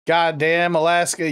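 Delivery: ordinary talk, nothing marked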